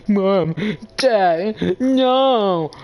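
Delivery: put-on high, loud whiny wail of a kid; hammed-up kids crying for their parents; mock crying kids. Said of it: "'cause all the kids are crying".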